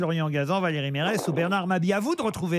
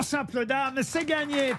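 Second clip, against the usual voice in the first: raised, ringing winner call; excited announcement; 7 semitones higher than usual. Acted. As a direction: call out the win with a shout. Host declares winner, shout.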